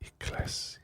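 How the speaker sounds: whispered, tentative repeat of a French word; under the breath. Real, not acted. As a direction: try the word barely audibly to yourself.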